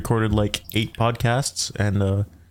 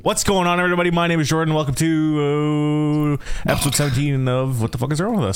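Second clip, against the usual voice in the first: big sing-out host greeting; show intro; 4 semitones higher than usual. Acted. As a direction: announce the show, stretching the welcome. Opens the show.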